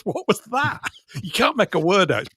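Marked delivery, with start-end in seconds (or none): 0.1-0.8 higher, softer mock bafflement; baffled question. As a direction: play it as a puzzled aside to the camera. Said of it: "Clarkson sometimes just looks at the camera and goes".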